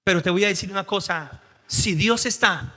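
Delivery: ordinary talk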